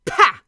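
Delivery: explosive contemptuous pah; scornful snort. Acted. Dwarf scoffing at the newcomer, loud scornful pah. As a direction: spit out the pah in disgust.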